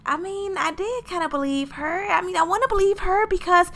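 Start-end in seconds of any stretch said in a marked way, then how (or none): none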